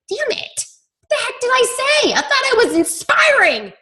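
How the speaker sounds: high, whiny mock dismay with a sob; mock distress. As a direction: squeal it like a dramatic little breakdown. Dismayed reply to worried message.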